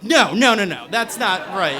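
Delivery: loud opening burst of no's, then easing off; imitated know-it-all correction; emphatic objection. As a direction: shoot down a wrong answer as someone you're imitating. Cutting off his recited answer.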